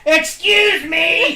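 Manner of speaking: booming megaphone shout, slow. Acted. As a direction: blast it through a megaphone. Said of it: "you hear an enormous megaphone voice".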